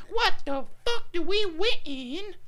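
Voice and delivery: very high, loud, stretched-out comic yell; hammed-up high shouting impression; Chris Rock. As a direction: screech it slowly like a hyped comedian. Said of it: "That's Chris Rock".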